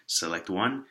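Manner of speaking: usual speaking voice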